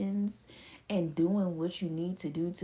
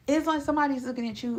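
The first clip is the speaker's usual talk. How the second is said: excited rush; 6 semitones higher, 6 dB louder, faster than usual. Real, not acted. Building up the admiration.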